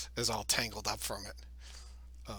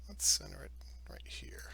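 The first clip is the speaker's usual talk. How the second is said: whispered; 3 semitones lower, 8 dB quieter than usual. Real, not acted. GM muttering while placing.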